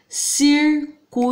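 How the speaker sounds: slow, split Circuito into separate syllables; teacher slowly separating Circuito into syllables. Shown as a demonstration. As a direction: spell out Circuito slowly, one syllable at a time. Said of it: "separando saúde".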